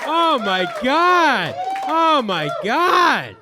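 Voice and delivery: loud, high, overjoyed shout to a cheering crowd; overwhelmed excited thanks. Real, not acted. Comic thanking a cheering audience on walking out, loud excited shout. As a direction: shout it out over the applause, drawing out the words.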